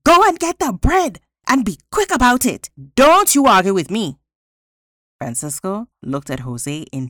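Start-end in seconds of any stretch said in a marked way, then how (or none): 0.0-4.2 high, loud, bossy shout. A narrator's reading. Wife ordering her husband to fetch bread, shouting. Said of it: "they heard the woman shout".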